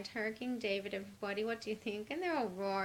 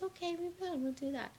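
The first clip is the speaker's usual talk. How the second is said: much higher, squeaky agreement; high mock voice; 9 semitones higher, faster than usual. Mercurian Queen agrees in a high squeak. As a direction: pipe up in a higher voice. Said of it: "She goes".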